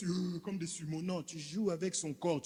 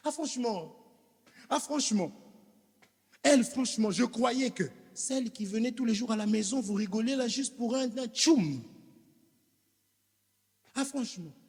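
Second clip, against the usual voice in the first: mock exasperated wife; 7 semitones higher, 4 dB louder than usual. Preacher voices exasperated wife.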